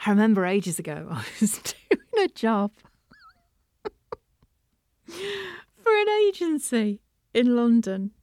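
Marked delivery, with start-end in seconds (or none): 1.1-2.7 breaking into a laugh mid-sentence; amused, laughter bubbling in; laughing while talking. Real, not acted. Laughing at the memory. 5.1-8.1 high, slow, squeezed out through laughter; real giggles lifting the voice; laughing while talking. Real, not acted. Struggles through laughter at memory.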